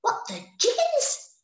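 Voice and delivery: very high squeaky shock; shocked gasp. A narrator's reading. Little bees discovering Norman is a bear, squeaky shock. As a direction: gasp it out in disbelief. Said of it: "gasped all the little bees".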